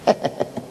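breathless unvoiced sneer of a laugh; a soft wicked snicker; evil chuckle. Satan sneers at Chaim with a soft evil laugh. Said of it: "Satan Malcham Avos walks over".

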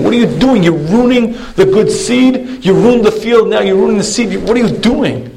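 high pitched, exasperated mock outrage; pleading with rising disbelief; outraged protest. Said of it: "screams the ci-, city man".

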